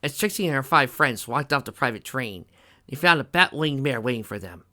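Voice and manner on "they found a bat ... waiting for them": usual speaking voice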